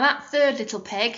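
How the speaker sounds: usual speaking voice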